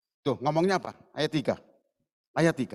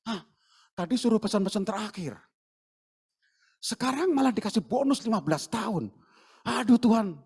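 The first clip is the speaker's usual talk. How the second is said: confused prophet; 4 dB quieter than usual. Pretend. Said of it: "Yesaya bingung".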